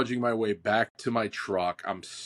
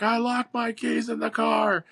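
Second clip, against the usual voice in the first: shouted; 12 semitones higher than usual. Retold or imitated. Shouting from outside truck.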